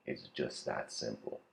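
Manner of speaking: low, hushed dawning realization; stunned quiet voice. Acted. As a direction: drop low and soft, awestruck. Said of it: "And the robot's like".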